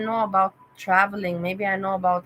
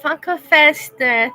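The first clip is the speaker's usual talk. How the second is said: loud greeting; 6 semitones higher, 9 dB louder, slower than usual. Real, not acted. Loud thanks to visiting fan.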